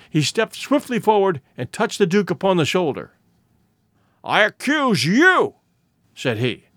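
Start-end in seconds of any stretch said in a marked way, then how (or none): 4.2-5.5 slow, higher-pitched "I accuse you"; "I accuse you" drawn out with deliberate weight; dramatic accusation. A narrator's reading. Holmes dramatically accuses the Duke.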